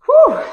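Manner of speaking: loud, high-pitched whew; exertion whew. Real, not acted. Coach near the last squat, loud high exertion whew. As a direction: whoop out the effort, high and loud. Nearly done with squat countdown.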